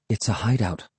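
soft, understated mutter. A narrator's reading. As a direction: mutter it as if thinking aloud. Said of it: "I muttered".

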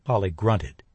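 ordinary delivery

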